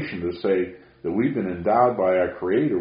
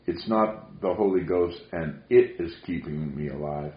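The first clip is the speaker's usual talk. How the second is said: ordinary delivery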